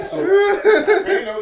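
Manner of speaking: high, slow, laughing through words. Real, not acted. Amused at friend, laughs through it.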